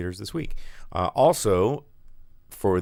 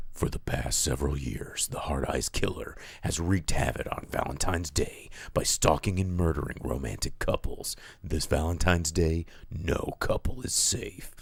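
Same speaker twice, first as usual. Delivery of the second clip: trailer voice; 2 semitones lower than usual. Shown as a demonstration. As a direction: read it like a horror trailer narrator. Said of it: "now that I've got this hoarse voice".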